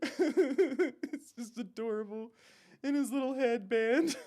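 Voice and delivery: high, slow cooing; melting at something cute; gushing adoration. Real, not acted. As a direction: coo over it through giggles. Gushing over cute character, laughing.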